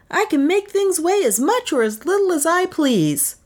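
high-pitched, showy inventor; boastful inventor claim. A narrator's reading. Inventor brandishes his baton before onlookers, boastful. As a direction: make the wild claim in a high voice. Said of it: "he claimed".

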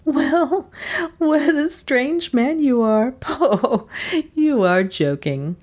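high, laughing and teasing; laughing wife. A narrator's reading. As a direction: laugh him off like a silly boy.

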